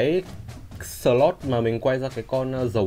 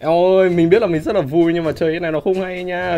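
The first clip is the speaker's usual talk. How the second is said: excited chatter; 7 semitones higher, 6 dB louder than usual. Real, not acted. Still excited after pull.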